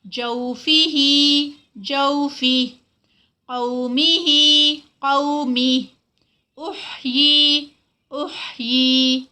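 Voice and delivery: tajweed teacher reciting the word list; Quranic recitation drill. Shown as a demonstration. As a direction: recite each word slowly in tajweed, then again.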